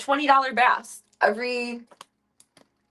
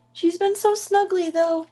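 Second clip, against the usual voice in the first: high, louder gush; gushing pet talk; 8 semitones higher, 5 dB louder than usual. Real, not acted. Gushing about her snuggly cat, high and louder.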